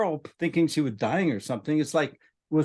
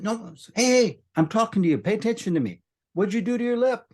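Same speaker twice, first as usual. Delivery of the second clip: put-on gentle voice to a child; 9 semitones higher than usual. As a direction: coax a frightened child. Calming a panicky little girl.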